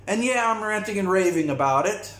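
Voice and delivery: ordinary talk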